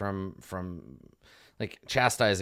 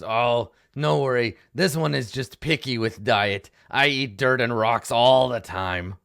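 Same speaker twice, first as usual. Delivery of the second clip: slow, louder gnoll in broken English; easygoing gnoll; 6 dB louder than usual. Acted. Basket gnoll reassures the gnome, slow and loud. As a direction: shrug off the apology cheerfully. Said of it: "he says".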